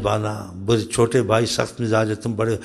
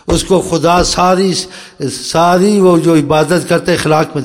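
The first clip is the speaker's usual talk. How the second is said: preaching emphasis; 4 semitones higher, 6 dB louder than usual. Real, not acted. Preacher stressing reward of good character.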